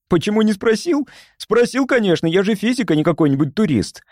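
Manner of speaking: high-pitched, offended protest; indignant. A narrator's reading. Physicist defending himself to Ежи, indignant. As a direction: throw the question back indignantly. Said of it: "возмутился Назарян".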